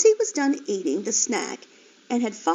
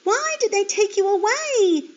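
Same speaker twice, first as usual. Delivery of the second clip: high, loud anguished plea; a little piglet's distressed question; pleading; 12 semitones higher, 5 dB louder than usual. Acted. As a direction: beg to know why.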